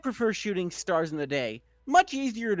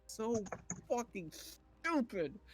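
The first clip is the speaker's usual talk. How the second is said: muttered aside; 12 dB quieter than usual. Real, not acted. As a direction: say it under your breath, fed up. Muttered aside about the bad fanfic.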